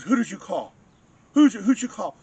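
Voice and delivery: high, loud alarmed questions; retelling a startled man's loud panic; panicked questioning. Retold or imitated. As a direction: blurt it out in a sudden panic. Retold man panicking about police.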